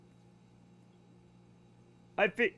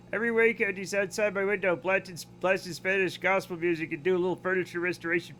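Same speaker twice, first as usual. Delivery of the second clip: normal talking voice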